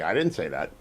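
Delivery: a quiet throwaway "I didn't say that"; muttered mock denial; mock denial, soft. Host echoing Biden's denial to guest, quiet and wry. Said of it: "like Rush Limbaugh in nineteen ninety-two".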